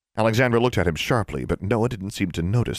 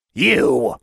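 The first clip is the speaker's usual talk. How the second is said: dwarf's cry; 7 semitones higher, 6 dB louder than usual. A narrator's reading. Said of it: "he cried".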